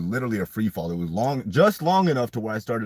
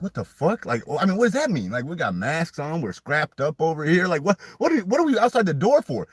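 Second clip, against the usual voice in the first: retold disbelief; 10 semitones higher, 5 dB louder than usual. Retold shock at the wrong text.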